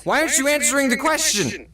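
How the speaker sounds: high, mock-impatient boss; annoyed boss. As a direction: imitate the boss's impatience.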